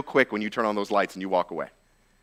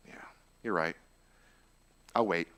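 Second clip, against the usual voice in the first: much softer, lower resigned reply; defeated; 7 semitones lower, 11 dB quieter than usual. Acted. A shamed believer gives in to shame, defeated. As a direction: give in quietly. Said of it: "what do you do?".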